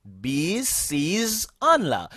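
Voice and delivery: pronouns drawn out one by one; slow, spaced language drill; slow model pronunciation. Shown as a demonstration. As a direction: recite the list slowly for learners to repeat. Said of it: "Répétez avec moi".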